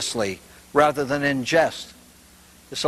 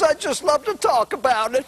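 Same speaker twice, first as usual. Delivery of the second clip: put-on high old lady voice; 11 semitones higher than usual. Priest and lady dialogue.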